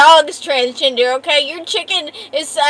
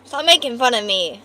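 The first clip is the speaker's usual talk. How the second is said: ordinary talk, nothing marked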